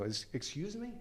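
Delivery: high, soft, hesitant question; baffled asking. Retold or imitated. Driver who can't understand the cop, soft baffled query. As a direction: stammer a puzzled request to repeat. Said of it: "I can't understand what he's saying".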